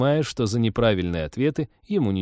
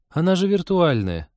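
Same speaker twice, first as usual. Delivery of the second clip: higher-pitched, let-down objection; mild disappointment in a raised pitch; disappointed; 9 semitones higher, 3 dB louder than usual. A narrator's reading. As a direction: sound a little let down. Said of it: "разочарованно сказала Лика".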